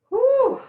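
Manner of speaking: high, loud hoot of relief; loud, high "whew". Real, not acted. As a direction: blow out the onion sting. Recovering from onion fumes.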